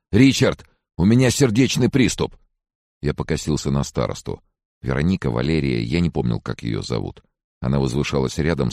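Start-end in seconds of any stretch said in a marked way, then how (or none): 0.1-2.4 high, loud panicked plea; hysterical. A narrator's reading. Francis phones a friend about a heart attack, hysterical. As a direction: cry out as if in mortal danger. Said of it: "истерические «Алло» Фрэнсиса".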